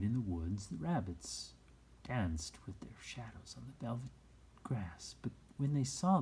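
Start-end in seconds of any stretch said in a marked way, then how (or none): none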